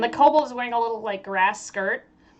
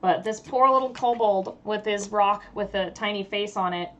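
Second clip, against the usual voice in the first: ordinary talk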